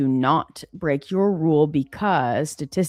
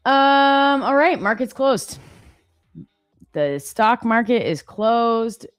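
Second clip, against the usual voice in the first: announcing; 7 semitones higher, 4 dB louder, slower than usual. Real, not acted. Announcing market close.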